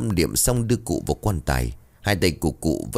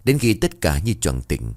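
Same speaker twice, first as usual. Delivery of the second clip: ordinary delivery, nothing marked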